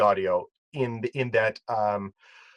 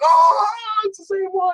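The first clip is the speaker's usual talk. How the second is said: very high, loud outburst; high-pitched retold exclamation; acted excited recognition; 5 dB louder than usual. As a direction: cry out in mock amazement at recognising it. Acts out recognising the truck.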